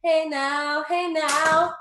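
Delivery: ordinary talk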